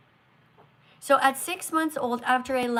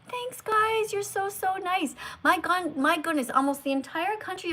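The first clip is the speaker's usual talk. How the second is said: delighted gush; 7 semitones higher than usual. Real, not acted. Delighted by viewers.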